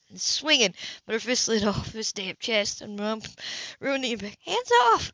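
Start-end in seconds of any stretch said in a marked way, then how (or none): 4.4-5.1 much higher, louder cry to let go; sharp shout. A narrator's reading. Anna to Cam as he grabs her, sharp higher shout. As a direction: shove the words at him.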